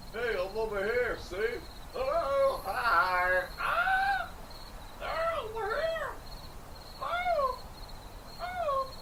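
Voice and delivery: distant high-pitched calls and squeals; high calls from afar. Acted. Greeting chat from across the room, high squeals.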